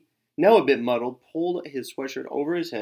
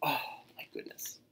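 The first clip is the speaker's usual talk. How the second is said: amazed gasp; 6 semitones higher, 10 dB quieter than usual. A narrator's reading. Narrator gasping in amazement at Tacky's success. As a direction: gasp then whisper.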